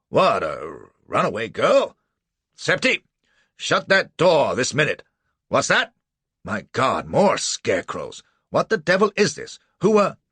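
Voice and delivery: put-on loud, high outraged old gentleman; pompous judge in a fluster; blustering judge. A narrator's reading. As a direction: splutter with indignation. Stout judge blustering at intrusion.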